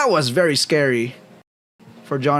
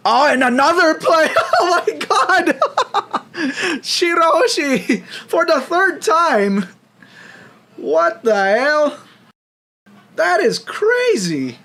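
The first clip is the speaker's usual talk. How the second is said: excited laughing shout; 13 semitones higher, 3 dB louder, slower than usual. Real, not acted. Caster's big play erupts.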